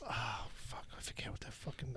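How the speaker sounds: hushed muttered swearing; whispered curse. Real, not acted. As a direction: curse under your breath. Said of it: "muttered under breath".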